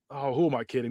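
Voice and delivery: usual speaking voice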